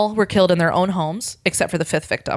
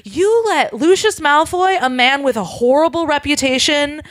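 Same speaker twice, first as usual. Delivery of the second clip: imitating an outraged lawyer, high and pitched up; mock outraged rant; 9 semitones higher, 3 dB louder than usual. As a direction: play her fury, hammed-up and high. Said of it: "she flies into a rage".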